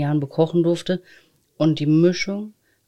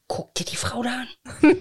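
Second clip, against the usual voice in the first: hushed, higher whisper; whispered aside; 7 semitones higher than usual. Retold or imitated. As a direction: whisper it like a secret thought. Retold thought about a stranger.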